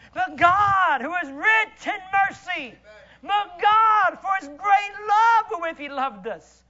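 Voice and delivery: loud, high shouted proclamation; preaching at full force. Real, not acted. Preaching climax.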